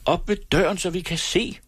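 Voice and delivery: high-pitched urgent call, not full volume; narrator's high, contained shout; crying out. A narrator's reading. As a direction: call out as a frightened girl. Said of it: "råbte Hedvig".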